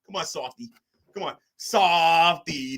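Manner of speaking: usual speaking voice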